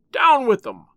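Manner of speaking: loud, high-pitched furious command; roaring order to attack; angry bull bellow. A narrator's reading. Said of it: "bellowed the sheriff in a voice like an angry bull".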